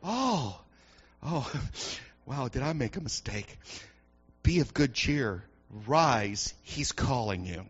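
low, soft embarrassed crowd; embarrassed crowd changing its tune; sheepish crowd. Acted. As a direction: mumble it sheepishly, caught out. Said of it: "they're telling him".

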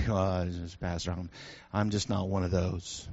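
low, soft mumbled excuse in mock reluctance; reluctant church member. Church member excusing himself to the pastor, mock reluctant. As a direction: lightly act a sheepish, low-voiced objection.

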